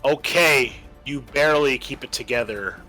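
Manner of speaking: normal talking voice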